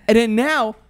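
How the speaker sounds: usual speaking voice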